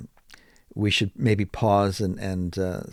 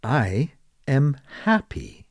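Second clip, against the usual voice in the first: slow and clear, each word spaced; careful teacher demo; slow model answer; 4 semitones higher, slower than usual. Shown as a demonstration. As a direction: say it slowly for students to copy. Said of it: "you might say".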